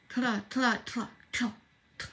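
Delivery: tries to trill the r in "Tra" and cannot; "Tra" repeated, attempting a rolled R without managing it; attempting to roll the R in "Tra", unsuccessfully; failed rolled R. Shown as a demonstration. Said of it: "I can't roll my R's still".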